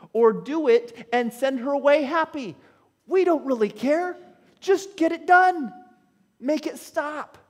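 plea rising in pitch to exasperated urging; begging. Acted. Disciples begging Jesus on her behalf, increasingly exasperated plea. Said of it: "they are begging Jesus now on her behalf".

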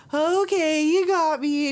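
mock giving in. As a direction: cave in like a charmed girlfriend. Jody giving in to Jack.

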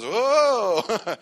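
high, loud gasp of wonder; the whole room gasping in amazement; amazed whoa. Retold or imitated. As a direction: crowd marvelling at the interpretation. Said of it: "so everybody goes".

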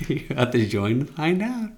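teasing through a laugh; giggling as you say it. Real, not acted. Teasing about secret chat room.

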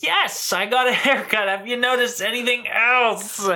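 impersonating his dad. Retold or imitated. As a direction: play dad fishing for a compliment. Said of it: "Dad says".

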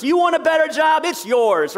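high, loud mock offer; mock salesman pitch of the prosperity gospel; mock promise. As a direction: sell it like a hyped-up promise. Preacher mocks prosperity promise.

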